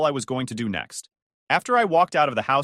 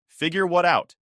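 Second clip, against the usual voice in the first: bitter retort, higher and louder; husband bitterly throwing the question back; 6 semitones higher, 5 dB louder than usual. Acted. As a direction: let the resentment show. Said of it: "not bothering to hide the bitterness".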